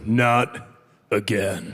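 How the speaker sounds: very low, slow menacing growl of a dealer. Retold or imitated. Dealer nose to nose warns the gambler, very low menace. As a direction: drop way down, nose to nose with me.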